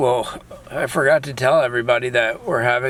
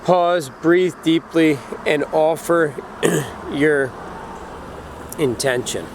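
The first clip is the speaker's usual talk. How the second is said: slow, calm instruction read; guided meditation; slower than usual. Acted. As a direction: read each step slowly and evenly. Reads ritual instructions slowly.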